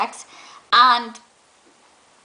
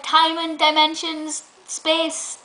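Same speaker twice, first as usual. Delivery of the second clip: slow, higher-pitched mimicry, pausing before the last word; slowly getting there; 5 semitones higher, much slower than usual. Contestant slowly working out what tardis stands for, imitated haltingly. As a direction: imitate someone feeling their way through an answer. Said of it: "she was slowly getting there".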